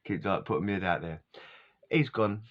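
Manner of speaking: ordinary delivery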